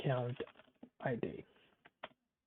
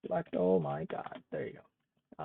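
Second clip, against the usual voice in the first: normal talking voice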